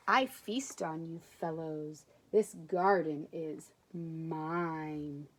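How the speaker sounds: low, very slow sinister snake voice; menacing snake. Acted. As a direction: threaten the little creatures slowly. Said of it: "hissed the snake".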